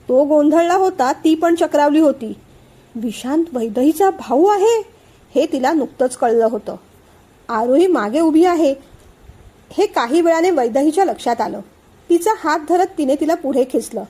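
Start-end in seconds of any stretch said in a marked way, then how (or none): none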